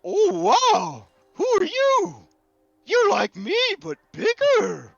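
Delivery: high, loud, wide-eyed excitable fellow; excited Gus. Acted. Meeting a bigger lookalike, amazed and excited. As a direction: gush with amazement, pitch leaping high. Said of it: "and he says".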